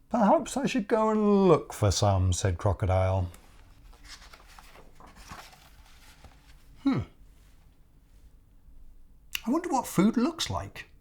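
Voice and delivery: high puzzled crocodile voice; a naive crocodile wondering aloud; crocodile character voice. Acted. Crocodile's lines in story.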